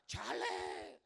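a breathy, dismissive "chale" put in the prophet's mouth; joking quoted slang; mock prophet's retort. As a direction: toss off "chale" like a Mexican street prophet. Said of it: "como dijo el profeta".

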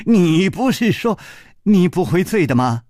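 high-pitched, half-amused reproach; acted annoyance with amusement in it; exasperated yet amused. A narrator's reading. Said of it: "楚留香又好气又好笑".

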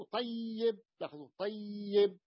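"طيب" drawn out on a doubled ya with kasra; doubled ya demo. Shown as a demonstration. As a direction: stretch the double y in "طيب", tay-yib. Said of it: "الأولى مكسورة وأدغمت الثانية في الأولى". (نطق "طيب" بياء مشددة مكسورة ممدودة للتوضيح)

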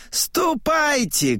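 high, drawn-out order; old man's slow high command. A narrator's reading. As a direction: send them off with a long high call. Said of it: "командует старик".

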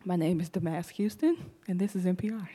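soft, measured public radio host sign-on; hushed, polished parody of an NPR presenter. Acted. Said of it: "That's how everybody talks with N P R".

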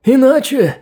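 rasping warning, voice strained high; after breathing tube. A narrator's reading. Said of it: "Сиплым голосом".

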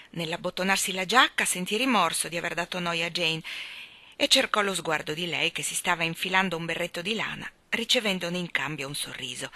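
ordinary delivery

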